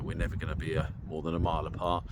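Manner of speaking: ordinary talk, nothing marked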